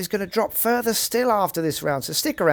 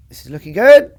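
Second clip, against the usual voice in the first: much louder rising cheer; excited; 4 semitones higher, 14 dB louder than usual. Real, not acted. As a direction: lift it loud as the ball nears. Shot rolling toward pin.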